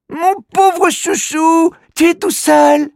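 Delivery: put-on high, slow doting child voice; cooing little girl. Alori pitying the little creature, slow cooing girl voice. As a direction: coo over him like a pet. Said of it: "demande Alori".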